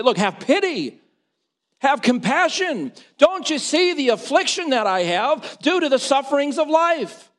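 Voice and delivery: high, loud preacher's cry for pity; pleading at full pulpit volume; impassioned plea. Acted. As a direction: cry out as a desperate supplicant. Voices blind men pleading with Jesus.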